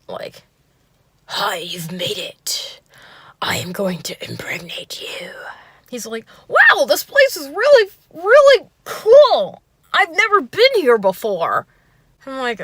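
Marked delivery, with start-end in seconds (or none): 1.2-5.3 slow, whispered mock gangster; badass gangster accent. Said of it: "gangster accent". 6.5-11.6 very high, loud mock gushing date; overzealous Bill Cosby. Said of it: "overzealous Bill Cosby meets".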